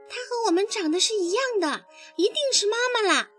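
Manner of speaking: put-on high, quick childlike voice; excited little tadpoles. A narrator's reading. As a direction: jump eagerly to a happy conclusion. Tadpoles mistake catfish for their mother.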